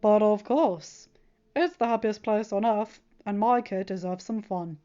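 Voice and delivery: lightly voiced doting dad; fond Tony. A narrator's reading. Tony tells his kid it's deserved, slow fond voice.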